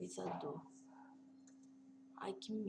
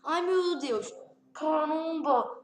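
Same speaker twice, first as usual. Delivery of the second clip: loud, drawn-out cry of shock; 6 semitones higher, 11 dB louder, much slower than usual. Real, not acted. Gamer shocked by surgery on screen, loud shocked cry. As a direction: gasp it out loud, stretching Caramba slowly.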